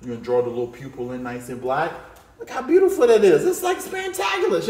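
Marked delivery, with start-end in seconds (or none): 2.4-4.7 loud, high burst of delight; bursting with pride in the drawing; excited. Real, not acted. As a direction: cheer the drawing on like a showman. Excited drawing teacher praising result.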